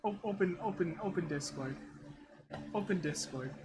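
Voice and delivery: muttered to himself. Real, not acted.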